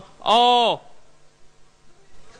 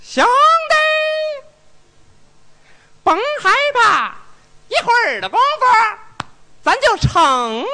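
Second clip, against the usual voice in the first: high shrill eunuch voice, much higher; shrill palace servant; 11 semitones higher than usual. Acted. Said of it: "这不学那太太那样吗".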